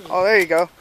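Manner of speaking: ordinary talk, nothing marked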